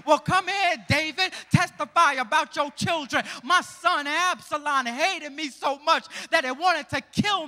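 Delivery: fervent sermon summons; preaching. Acted. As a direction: call the witnesses up. Preaching, calling biblical figures.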